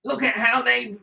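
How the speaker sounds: high-pitched comic impression; put-on high, odd comedian voice; Richard Ayoade. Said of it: "do your Richard Ayoade".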